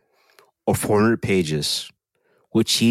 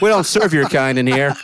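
hostile shopkeeper; 5 semitones higher than usual. Pretend. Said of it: "walk in there".

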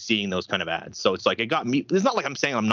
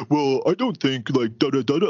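lightly voiced dopey quote; mocking put-on voice; 3 semitones higher than usual. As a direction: poke fun with a dim voice. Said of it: "I do this, like, voice".

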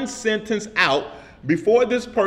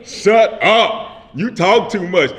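louder, punchy shout of the title through a laugh; loud callout; 6 dB louder, much slower than usual. Shown as a demonstration. Preacher belts the Run DMC hook at the congregation: loud laughing shout. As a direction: belt it like the rap hook.

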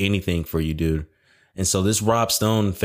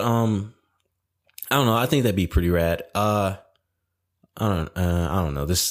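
usual speaking voice

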